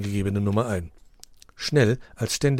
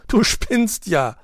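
laughing; 12 semitones higher, 7 dB louder, much slower than usual. A narrator's reading. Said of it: "Teddy lachte laut auf".

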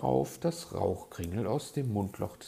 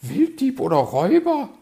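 high, loud, outraged question; affronted magpie, voice way up; indignant echo; 15 dB louder, faster than usual. A narrator's reading. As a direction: squawk it back in disbelief. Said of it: "meinte sie entrüstet".

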